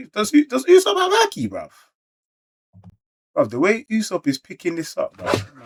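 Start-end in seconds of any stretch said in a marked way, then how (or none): 0.1-1.7 much higher and louder, stunned; shocked disbelief. Real, not acted. Asking chat about Usopp's haki, stunned high disbelief. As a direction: gasp out the question in disbelief. Shocked reading manga reveal.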